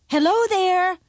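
high, loud hail; calling out. A narrator's reading. Eldest son calls into the dragon's cave, hailing. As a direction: call up high toward the door. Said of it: "and called out".

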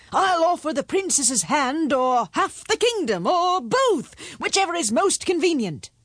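high, emphatic royal proclamation; grand, high-pitched king voice; bargaining king. A narrator's reading. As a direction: pile on the offer as an eager king.

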